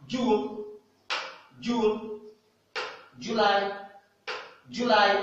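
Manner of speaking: June et July dits haut et fort; June, July en modèle, voix haute; English model words. Shown as a demonstration. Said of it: "prononciation you et ou". (June et July à l'anglaise, plus forts)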